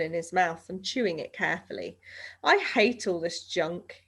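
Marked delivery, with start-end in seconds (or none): none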